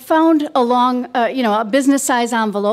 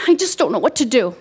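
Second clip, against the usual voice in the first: ordinary talk, nothing marked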